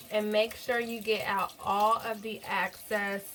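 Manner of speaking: quiet, even how-to narration; gentle tutorial voiceover, held well below normal level; soft voiceover. Acted. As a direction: talk viewers through the cleaning steps softly.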